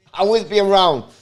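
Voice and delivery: normal talking voice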